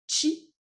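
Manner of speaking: "chi," pitched well up, sharp and short; Japanese chi. Shown as a demonstration. Teacher calling out the syllable chi to students, high and sharp. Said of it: "Cha, c'est la ligne des T".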